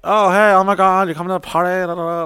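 high, gushing mock greeting; gushing party greeting. Waiters greeting each other in the kitchen, gushing. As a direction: lightly play the excited party guys.

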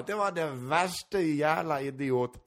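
slow put-on Gotlandic accent, badly done. Mocking the woman who called him an idiot, in bad Gotlandic accent. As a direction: drawl it out in a clumsy Gotlandic accent. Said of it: "dålig på gotländska".

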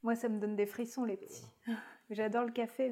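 ordinary talk

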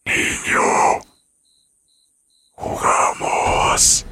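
very slow, drawn-out ghostly whisper; macabre ghost whisper. A narrator's reading. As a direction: stretch it out like a ghost calling. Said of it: "nuevamente esa macabra voz".

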